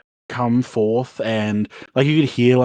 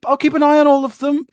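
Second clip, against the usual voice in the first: nervous eager promise; 13 semitones higher, 5 dB louder, faster than usual. Retold or imitated. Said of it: "Mathis is like".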